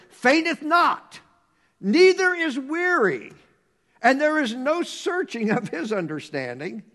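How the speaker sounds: building to loud, high proclamation; scripture declamation. Acted. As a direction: proclaim the verse with rising force.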